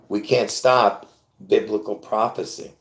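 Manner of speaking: usual speaking voice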